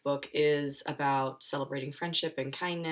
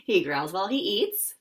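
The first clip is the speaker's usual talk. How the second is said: laughing; 4 semitones higher, 6 dB louder than usual. Real, not acted.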